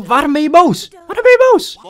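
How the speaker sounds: high-pitched loud demand to know; exasperated shouting. Real, not acted. Player asking his game character why he's angry, exasperated. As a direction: shriek the question in exasperation.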